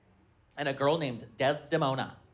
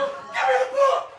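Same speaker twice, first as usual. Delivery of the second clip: much higher shrieking demand, louder, laughing; frantic mock yell; 3 dB louder than usual. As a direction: grab for the book in a panic. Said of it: "And I go".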